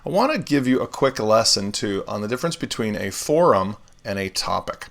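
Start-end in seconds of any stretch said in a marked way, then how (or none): none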